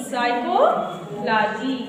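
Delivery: ordinary talk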